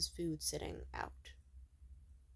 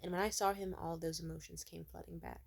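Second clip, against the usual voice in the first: ordinary talk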